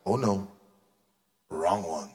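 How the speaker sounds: very low, soft groan of disappointment; deep muttered oh no; crowd's dismay. Retold or imitated. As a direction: sigh out the mistake. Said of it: "everybody goes like".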